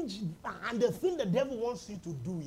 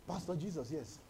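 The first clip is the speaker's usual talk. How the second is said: ordinary talk, nothing marked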